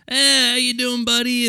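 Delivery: high, drawn-out hearty greeting; mock old guy glad-handing a buddy; put-on high back-slapper voice; old co-worker. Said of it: "the old person at any sort of job".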